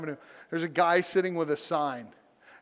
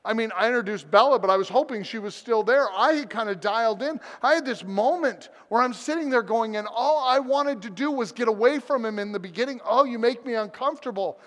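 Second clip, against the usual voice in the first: higher, urgent pulpit delivery; impassioned preaching; 6 semitones higher, 3 dB louder than usual. Real, not acted. Pastor confessing to the church, preaching with passion. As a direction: drive the lesson home with rising fervor.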